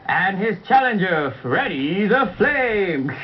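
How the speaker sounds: slow, drawn-out ring announcer call; booming fighting game announcer. Pretend. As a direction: stretch the name out for the crowd. Puppet wrestling match introduction.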